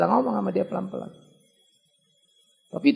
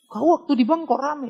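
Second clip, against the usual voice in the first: mock girlfriend scolding; 8 semitones higher than usual. Girlfriend retold, scolding the boyfriend.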